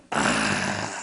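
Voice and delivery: a deep, far-off monster's "Ah"; low eerie gasp. Acted. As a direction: let out a low inhuman breath. Snow woman reveals herself.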